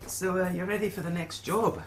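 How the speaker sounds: lightly voiced quest-giver Willie; lightly put-on voice, lifted at phrase starts; innkeeper Willie. Innkeeper offering adventurers payment options, lightly voiced character.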